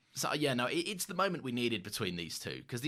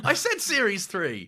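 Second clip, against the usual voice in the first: laughing correction; 8 semitones higher, 6 dB louder, slower than usual. Real, not acted.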